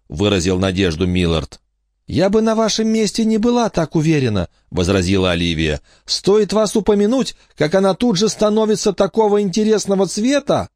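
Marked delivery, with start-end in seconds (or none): 2.1-10.7 put-on much higher childish, teasing warning; small girl who knows better; knowing little girl. Olivia warns.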